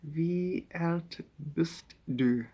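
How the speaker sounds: Wie alt bist du drawn out very slowly; slow German. Shown as a demonstration. Practising German to the room, slow and deliberate. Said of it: "Quite a nice language".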